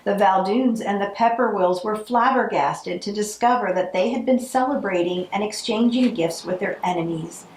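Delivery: usual speaking voice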